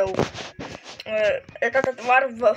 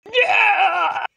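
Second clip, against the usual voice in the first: high desperate scream; despairing scream; 9 semitones higher, 5 dB louder than usual. Acted. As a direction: scream as you fall.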